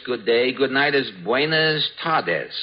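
ordinary talk, nothing marked

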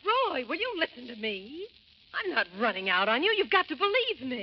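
high, insistent plea; exasperated appeal in a quarrel; urgent pleading. Acted. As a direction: beg to be believed, pitch climbing. Woman pleads with angry partner.

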